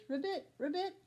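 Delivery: high croaking frog sound. A narrator's reading. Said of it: "but then he hears".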